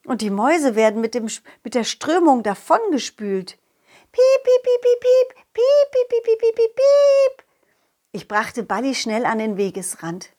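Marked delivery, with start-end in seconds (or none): 4.1-7.4 very high, loud squeaks; squeaking mice. Acted. Mice squeak as the current sweeps them away. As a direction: squeak like tiny mice in trouble.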